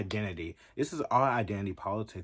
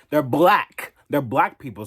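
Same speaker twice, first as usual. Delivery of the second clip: a much louder, higher, hammered claim; loud declaration; 5 semitones higher, 12 dB louder than usual. Real, not acted. Vlogger shouting her claim at viewers, loud. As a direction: bark it out defiantly.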